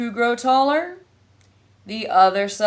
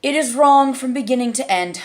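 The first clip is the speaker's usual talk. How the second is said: firm, louder caterpillar verdict; decided; 5 semitones higher, 9 dB louder than usual. A narrator's reading. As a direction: state it flatly, no argument. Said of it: "said the caterpillar decidedly".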